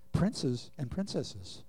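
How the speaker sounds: "princes and princesses" drawn out slowly; slow emphasis. Shown as a demonstration. Preacher telling believers who they are, slow emphasis. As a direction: spell out "princes and princesses" word by word. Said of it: "slow emphatic declaration".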